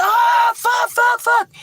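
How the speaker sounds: high frantic shout of panic; panicked yelling. Retold or imitated. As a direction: yell it like you just saw something terrible. Tommy panicking at the bus.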